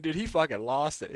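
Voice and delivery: ordinary talk, nothing marked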